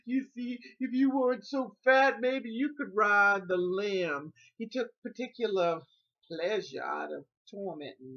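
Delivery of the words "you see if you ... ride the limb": imitated sing-song sibling taunt, pitched higher; taunting brother. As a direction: tease like a gloating big brother. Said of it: "and he's like".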